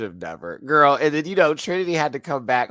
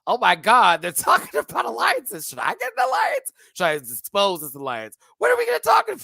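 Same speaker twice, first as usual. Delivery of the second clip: high, loud, flustered panic; spilling the tea in a panic; excited confessional; 8 semitones higher, 7 dB louder than usual. Retold or imitated. Confessional of the queen.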